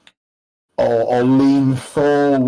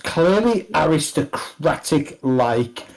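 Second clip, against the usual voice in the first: usual speaking voice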